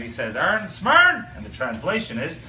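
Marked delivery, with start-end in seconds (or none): 0.3-1.2 raised, louder call in Newfoundland dialect; higher, punchy Newfoundland fisherman's hail; Newfoundland captain. Acted. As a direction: call across the water in thick Newfoundland. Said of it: "one fishing vessel captain looks over".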